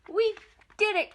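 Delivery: high, loud cry of triumph; excited cry. A narrator's reading. Toad cheering as the kite climbs, excited. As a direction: burst out with joy. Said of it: "cried Toad".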